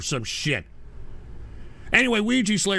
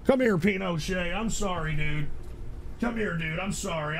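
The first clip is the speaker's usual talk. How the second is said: soothing apology; 5 dB quieter than usual. Real, not acted. Streamer comforting upset Pinochet, soft apology. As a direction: drop to a gentle, quiet apology.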